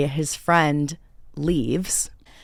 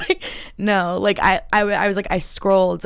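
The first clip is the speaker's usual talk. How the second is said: high laugh then louder; embarrassed laugh; 4 semitones higher, 5 dB louder than usual. Real, not acted. Embarrassed she didn't know.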